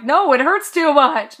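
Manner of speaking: high, loud, drawn-out mock whine; exaggerated pained refusal; retold protest. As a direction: protest loudly, dragging it out. Retold protest about flexing knee.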